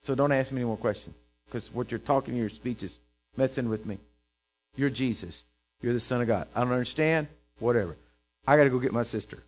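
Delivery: low, soft, dismissive grumble; mock flustered retreat. Preacher voicing Martha giving up on Jesus's questions, low flustered grumble. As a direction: back out of the conversation under your breath.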